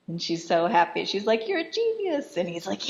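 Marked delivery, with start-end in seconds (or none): 1.5-2.4 high-pitched delighted gush; gushing admirer, pitched way up. Retold or imitated. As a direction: coo it high, in mock admiration. Acted delighted reader praising him.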